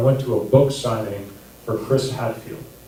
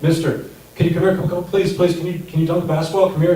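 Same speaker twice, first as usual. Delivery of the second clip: mock-excited kid pleading, higher and fast; imitating eager kids begging; 5 semitones higher, faster than usual. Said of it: "they get really excited".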